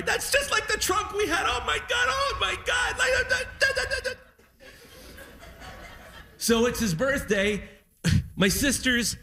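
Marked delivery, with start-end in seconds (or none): 0.0-4.1 high, wailing and choked with emotion; imitating dad sobbing at the sight of the trunk; overwhelmed tearful dad. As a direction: cry out like an old man undone by memories. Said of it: "everything made him cry".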